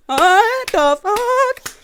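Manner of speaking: high, loud, slowly drawn-out disbelief; shocked outburst. Retold or imitated. Joey walking in on the bloody scene, stunned and loud. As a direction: stretch it out in total shock.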